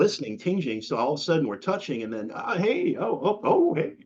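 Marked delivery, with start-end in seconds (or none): 2.3-4.0 high, quick mock yelps of surprise; playful high-pitched startled oh-oh-oh; startled exclamations. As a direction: act out being caught off guard mid-spar. Acting out the stick sparring surprise.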